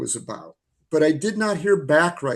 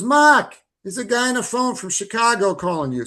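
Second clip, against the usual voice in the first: wife calls out higher, in a put-on Massachusetts accent; mock New England accent; 6 semitones higher than usual. Wife calling her husband to the phone, New England accent. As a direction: shout his name across the house, New England accent. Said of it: "Fidrich accent here".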